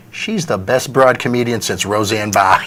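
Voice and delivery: Lenny Clark's voice. Retold or imitated. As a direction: drop low and slow like the old comic. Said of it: "He goes".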